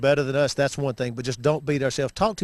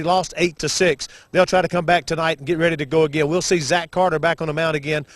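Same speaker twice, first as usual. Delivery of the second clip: upbeat play-by-play announcer read; sports broadcast intro; 4 semitones higher than usual. Acted. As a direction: open the broadcast like a radio host. Broadcaster opens the game show.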